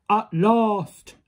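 big, loud giant voice; booming giant. A narrator's reading. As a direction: boom it out like a huge giant. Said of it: "boomed the big voice of the giant".

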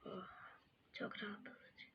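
soft relaxed whisper. Real, not acted. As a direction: breathe it out softly. Relaxing bubble sounds, whispered.

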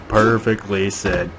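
"Perfectly said" stretched out slowly; drawn-out praise. Acted. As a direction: savour "Perfectly said", word by word. Repeats praise, drawing it out.

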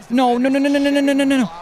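fast high string of no's; frantic no. Real, not acted. Opponent breaks a big run.

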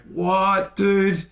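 high, drawn-out disbelief; astonished appeal to the heckler; incredulous. Retold or imitated. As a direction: pitch it up in baffled protest.

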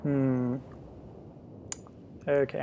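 normal talking voice

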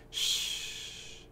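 soft, voiceless shh; long quiet shush; soothing shush. A narrator's reading. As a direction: gently hush someone who is panicking. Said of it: "he's trying to silence me".

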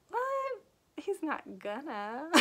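high-pitched shocked "what", then drawn out slowly; acted-out incredulous gasp of a reader; disbelief. Reader reacting to a shocking plot twist, in disbelief.

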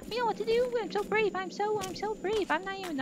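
very high-pitched pep talk; nervous self-encouragement. Real, not acted. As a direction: squeak out a show of bravery.

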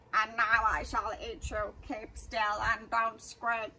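slow gurgling monster voice. Acted. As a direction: gloat it with a wet gurgle. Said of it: "it gurgled".

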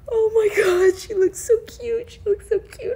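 high squeaky gushing. Real, not acted. Collector seeing doll up close, high gush. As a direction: coo over the doll up high.

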